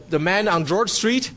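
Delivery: ordinary talk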